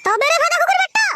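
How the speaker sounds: high, rising war cry; furious lunge. Acted. Attacking after the insult to mother, furious.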